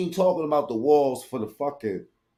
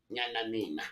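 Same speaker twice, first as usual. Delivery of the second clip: mocking nyah babble; 4 semitones lower, 12 dB quieter than usual.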